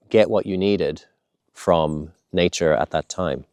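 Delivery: usual speaking voice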